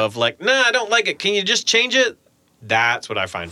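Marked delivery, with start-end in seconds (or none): 0.4-2.2 pitched way up, put-on dismissive client voice; hammed-up impression of a vague client, high and a bit louder; mock fussy client. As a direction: mimic a client who can't say what they want, much higher. Mocking vague client revision notes.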